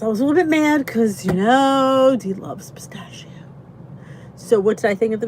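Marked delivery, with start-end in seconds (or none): 2.2-3.3 whisper. Real, not acted. Aside about herself to chat.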